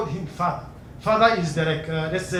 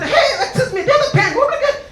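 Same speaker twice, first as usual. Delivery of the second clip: high, calling out; lightly hammed-up guard; mock guard voice. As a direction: call out like a nosy guard. Compound guard voiced in story.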